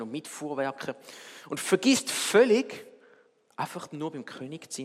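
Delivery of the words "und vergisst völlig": ordinary talk, nothing marked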